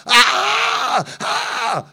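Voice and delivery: short high mock scream, not full volume; two quick imitated screams; retold scream. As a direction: imitate the woman's sudden shrieks. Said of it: "A really high-pitched screaming sound".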